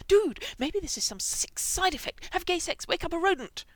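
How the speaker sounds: high, loud excited rush of ideas. Acted. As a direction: blurt the theory, high and eager. Frank excitedly theorizes.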